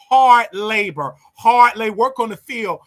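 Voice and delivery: loud, slow, hammering each word; emphatic shout. Real, not acted. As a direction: bang out each word hard. Ranting about punishment.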